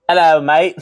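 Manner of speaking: hammed-up British, high and loud; bad British accent. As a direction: butcher a British accent, laughing. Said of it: "an American doing a bad British accent".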